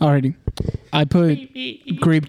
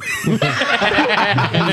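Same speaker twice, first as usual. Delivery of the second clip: higher-pitched cracking up; laugh; 4 semitones higher than usual. Real, not acted. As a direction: break character laughing. Breaks character after failed accent.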